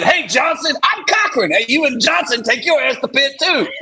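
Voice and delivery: high shouted drill instructor. Retold or imitated. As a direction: yell it like a DI at recruits. Drill instructor voiced in story.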